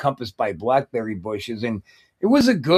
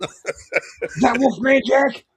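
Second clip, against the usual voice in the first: laughing; 9 semitones higher, much slower than usual. Real, not acted. Joking while laughing.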